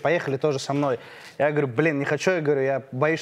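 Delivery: normal talking voice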